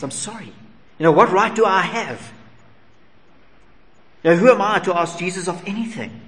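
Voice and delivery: a soldier begging pardon for asking; voiced apologetic centurion.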